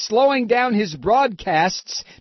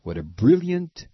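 sarcastic; 10 semitones lower, 6 dB quieter than usual. Acted. Sarcastic comment after the mock plot.

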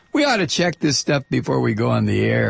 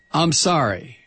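ordinary talk, nothing marked

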